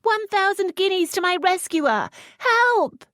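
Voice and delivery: very high, loud shout; high scream for help. Acted. Lisette screaming for rescue, very high and loud. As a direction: scream it through the door.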